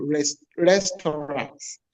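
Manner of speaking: restaurants drawn out slowly, rest-o-rants; slow careful restaurants; slow demo. Shown as a demonstration. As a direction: spell out restaurants at half speed. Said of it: "We call restaurants".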